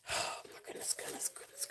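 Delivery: breathy hushed exclamation under the breath; real whisper. Real, not acted. Seller whispers in dismay as her cat gets into everything. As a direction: whisper it so the stream barely hears.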